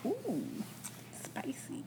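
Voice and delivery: whispered aside; playful whisper. Pretend. Reacting to cohost's audacity jab, teasing whisper. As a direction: drop to a hushed whisper.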